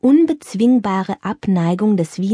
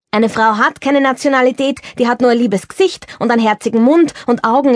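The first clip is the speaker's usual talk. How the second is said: indignant Girardi; 2 semitones higher, 4 dB louder than usual. Acted. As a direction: brush off the sermon with quick indignation. Girardi raves about Odilon. (Girardi wehrt Berthas Moralpredigt ab, empört und schnell)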